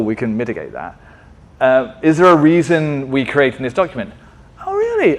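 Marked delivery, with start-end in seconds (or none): 4.6-5.2 a sudden high squeak of disbelief; mock surprise. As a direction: act out being caught off guard, jumping way up in pitch. Mock dialogue questioning the process.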